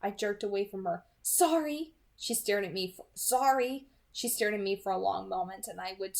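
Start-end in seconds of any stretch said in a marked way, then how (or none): 1.2-1.9 quick, louder startled sorry; startled apology. A narrator's reading. Bo jerks away. 3.1-3.9 quick, louder startled sorry; startled apology. A narrator's reading. Bo jerks away.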